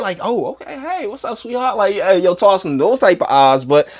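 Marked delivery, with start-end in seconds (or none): 0.2-1.8 flirty mock greeting. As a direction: coo it like you're hitting on a woman. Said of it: "smiling like that at, uh, a bad chick".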